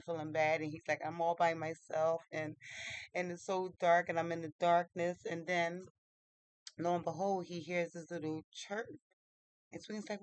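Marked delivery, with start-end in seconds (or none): none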